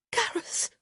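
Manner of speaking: high, airy gasp of his name. A narrator's reading. Woman gasping her lover's name. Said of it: "she gasped".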